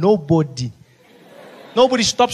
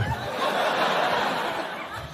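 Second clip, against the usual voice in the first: wheezy laugh; 7 dB quieter than usual. Acted. Laugh after punchline.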